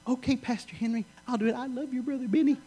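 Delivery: high, eager woman's voice; woman eagerly agreeing to hug the evangelist. Retold or imitated. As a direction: squeal it out like a thrilled fan. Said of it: "She goes".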